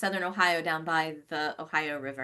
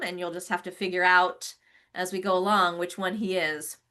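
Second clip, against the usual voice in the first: ordinary talk, nothing marked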